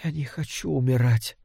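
soft, feeble confession; weak, faint voice. A narrator's reading. Ailing wife telling her husband her fear of dying, faint voice. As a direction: barely push the words out. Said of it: "Голос был едва слышен".

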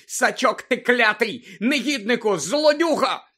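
high, loud volley of insults; screaming місіс Фіг. A narrator's reading. Old woman cursing her lookout, screaming. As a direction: hurl each insult furiously. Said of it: "закричала місіс Фіг".

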